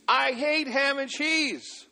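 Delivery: put-on very high, slow wailing child voice; imitating a tantrum; mock crying toddler. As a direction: sulk and wail like a kid. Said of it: "Starts crying again".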